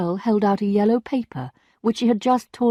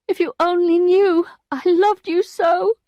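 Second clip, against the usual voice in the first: sighing lament; 11 semitones higher, 6 dB louder than usual. A narrator's reading. Emma sighing to Rodolphe of how she loved him. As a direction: pour out her old love. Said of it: "she sighed".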